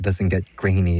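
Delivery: ordinary talk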